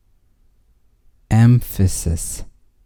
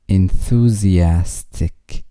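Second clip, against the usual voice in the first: Enthusiastic said slowly, syllable by syllable; slow model; much slower than usual. Shown as a demonstration. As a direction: stretch out each syllable of Enthusiastic for the learner. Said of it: "vocabulary drill, word repeated".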